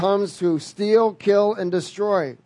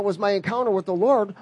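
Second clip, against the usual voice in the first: ordinary talk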